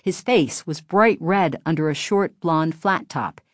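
plain reading voice

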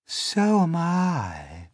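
high, slow ladybird. Acted. Ladybird agreeing she is hungry, high and slow. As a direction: lift it high and stretch it out.

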